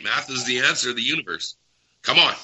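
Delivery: ordinary talk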